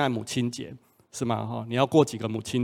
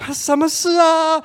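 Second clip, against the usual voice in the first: much higher, slow, drawn-out lover's question; lovesick eager question; 5 dB louder, slower than usual. Retold or imitated. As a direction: stretch it out like a smitten boyfriend. Acted lovestruck reply on phone. (拉长音、高声的恋爱语气)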